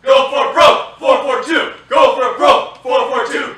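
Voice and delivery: loud chanted battle cry; rallying shout. Acted. Soldiers chanting their motto together, loud and proud.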